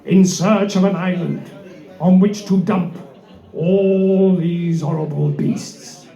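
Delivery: dramatic low storytelling. Acted.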